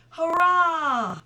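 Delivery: loud, high joyful "Hurrah!"; cheering yell. Acted. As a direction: cheer it out loud like excited girls. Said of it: "yelled Mattie and I".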